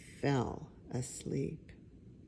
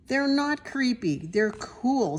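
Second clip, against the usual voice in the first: put-on insistent kid voice; 8 semitones higher, 3 dB louder than usual. Child voice insisting.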